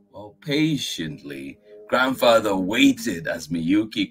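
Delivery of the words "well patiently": ordinary delivery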